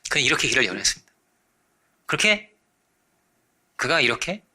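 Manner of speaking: ordinary delivery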